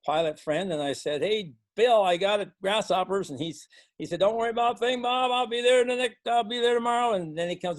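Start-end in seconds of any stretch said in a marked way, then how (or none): 1.2-3.3 a touch of alarm on the phone; calling out. Retold or imitated. Calling pilot friend about grasshoppers. 4.2-7.1 higher, louder breezy can-do voice; lightly voiced cocky pilot friend; confident pilot. Pilot friend reassures farmer.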